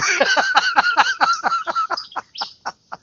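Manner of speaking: very high wheezing laugh; high-pitched helpless laughter; squealing laugh. Real, not acted. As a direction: cry laughing. Laughing hard.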